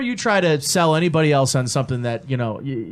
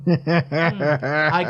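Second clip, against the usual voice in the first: laughing. Real, not acted. Musing laugh before a joke.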